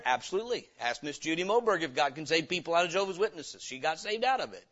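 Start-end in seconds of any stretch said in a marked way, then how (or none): none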